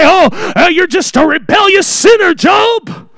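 rising, scornful accusation hitting Job's name high; accusing friends. Retold or imitated. Friend rebuking suffering Job, scornful and rising. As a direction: scold him as a self-righteous friend would.

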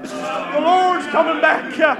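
exultant full-force sermon climax; fervent preaching. Acted. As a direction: proclaim it triumphantly to the whole church.

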